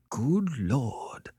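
whispery, blank astonishment; blankly. A narrator's reading. Beverly hears Annette call herself a fiend, blankly. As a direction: breathe it out, dumbfounded. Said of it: "said Beverly blankly".

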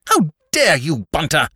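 indignant mistress rebuking a servant; furious. A narrator's reading. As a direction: draw it out in loud indignation. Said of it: "erect and furious".

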